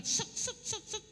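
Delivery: imitated rapid "set" zips; high, sharp little bursts of "set"; quick darting noises. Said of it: "Kerjanya cepat". (tiruan bunyi serangan cepat)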